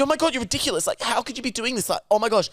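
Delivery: high, shocked mock exclamation; outraged guy. Angry guy scolding the brother, high and shocked. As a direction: gasp it out way up high. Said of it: "He's going".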